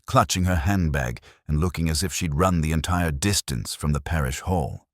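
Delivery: plain reading voice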